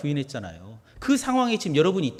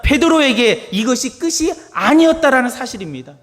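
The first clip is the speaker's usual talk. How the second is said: loud, high-pitched preaching; fiery pulpit delivery; preaching at full force; 9 semitones higher, 9 dB louder than usual. Acted. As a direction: proclaim it to the congregation.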